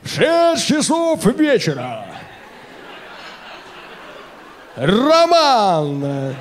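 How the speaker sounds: slow, booming ring announcer call. Retold or imitated. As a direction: stretch each word like a boxing MC. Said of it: "который вот ринг-анонсер".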